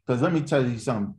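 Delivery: ordinary talk, nothing marked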